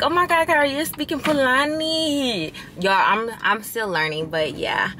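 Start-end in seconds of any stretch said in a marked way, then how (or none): 0.0-2.5 high, excited mock fan squeal; a hint of an impressed follower, high and gushing. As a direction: play an amazed viewer, pitched way up. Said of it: "y'all are like".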